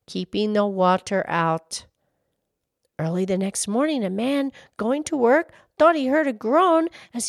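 storybook reading voice; story narration. Acted. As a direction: read it like a bedtime story. Reads a children's story aloud.

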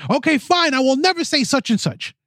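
high-pitched huffy comeback; exasperated mock retort. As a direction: give in huffily, pitch jumping up. Acts out a spousal argument.

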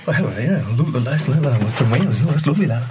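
a playful, barely-there stab at the Welsh actor; bad Hopkins impression. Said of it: "That's the worst Hopkins".

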